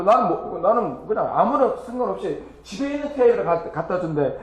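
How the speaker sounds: normal talking voice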